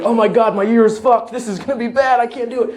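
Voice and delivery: high-pitched mock panic; freaking out. Driver who hit a worker, freaking out. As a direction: act out a driver in a rising panic. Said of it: "you're freaking out, like".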